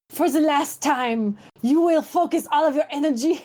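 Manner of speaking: put-on exasperated French-accented command; angry French captain. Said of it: "I guess they're French".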